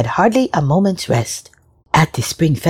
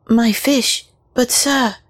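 put-on tearful young girl; 7 semitones higher, slower than usual. A narrator's reading. Girl voiced in story.

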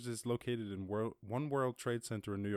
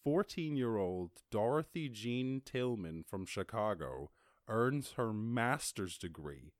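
ordinary talk, nothing marked

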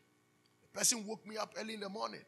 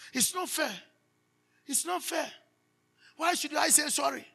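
higher, whiny protest of someone who won't apologise; mock complaint; 7 semitones higher than usual. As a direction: play the sulking child who refuses to say sorry. Mimics a stubborn person refusing.

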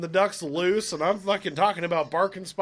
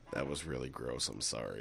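a low, much softer, mumbled sorry; sheepish apology; 12 dB quieter than usual. Retold or imitated. Friend returning to the group, quietly apologising for his fart. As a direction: mumble it, ashamed after coming back. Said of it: "he goes".

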